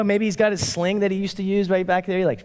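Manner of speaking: ordinary talk, nothing marked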